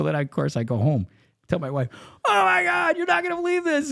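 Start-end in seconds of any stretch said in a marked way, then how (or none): 2.2-3.9 high-pitched, loud, gushing retold outburst; excited shout. Husband comes home to tell his wife, excited shout. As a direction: burst in shouting with thrilling news.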